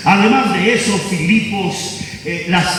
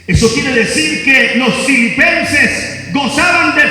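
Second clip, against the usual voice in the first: voz de predicador, más aguda y enérgica; predicando con fuerza; 7 semitones higher than usual. Acted. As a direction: proclama a la congregación con fervor. Pastor preaching to congregation. (predicación apasionada)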